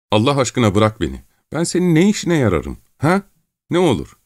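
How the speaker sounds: high-pitched, whining entreaty; desperate pleading. Acted. Gavrila begging Çelkaş to release him, desperate pleading. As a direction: beg with rising desperation.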